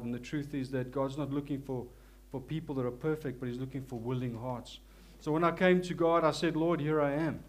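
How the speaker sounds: normal talking voice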